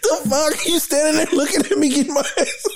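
high, drawn-out pleading through laughter; mock yelling for help. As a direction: beg for help in a squeaky comic wail. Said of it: "My ass would have been yelling".

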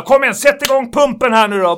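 loud, high, pushy customer voice; angry customer. Retold or imitated. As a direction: bark it like an impatient customer.

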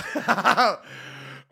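sudden high burst of laughter; big laugh. Real, not acted. Podcaster laughing at himself while begging listeners for help. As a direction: crack up at your own idea. Laughs at his own plea.

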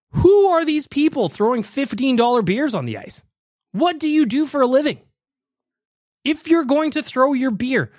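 high-pitched, indignant demands; exasperated rant. Real, not acted. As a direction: rant in disbelief, pitch pushed up. Incredulous rant at beer throwers.

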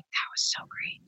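hushed breathless whisper of the boss on the phone; retold whisper. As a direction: whisper it, creepy and intimate. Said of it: "Jeffrey called me and he's like".

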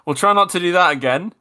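ordinary talk

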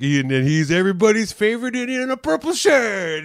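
mock emcee intro. Host introducing for laugh.

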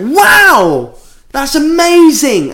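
very high, loud gushing, put on; over-the-top enthusiasm. Retelling his reply to a sponsored skydive, overdone enthusiasm. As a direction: act wildly amazed, drawing it out. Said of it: "over the top enthusiastic about it".

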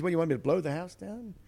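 mock the pig's indignant question. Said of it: "He goes".

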